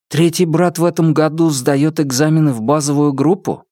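ordinary delivery

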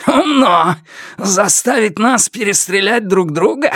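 high, strained taunt; villain calling out. A narrator's reading. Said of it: "последовал приступ яростного кашля. Тем не менее он продолжил". (высокий язвительный выкрик злодея)

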